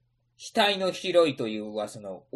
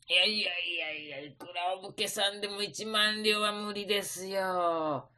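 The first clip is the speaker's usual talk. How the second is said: merchant voice; 2 semitones higher, 6 dB quieter than usual. Acted. Merchant refusing politely.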